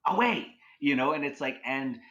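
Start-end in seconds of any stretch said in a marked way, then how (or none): 0.1-0.5 sudden high, louder imitated call; a mock jump-scare shout; startling man's call. Repeating the recorded man's voice for the listener, startling shout. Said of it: "almost like to startle you".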